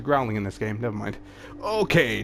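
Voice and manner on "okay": higher lightly voiced dwarf; mock agreement again; Torbjorn okay retake. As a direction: Torbjorn answering Brigitte after the interruption. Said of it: "Torbjorn said".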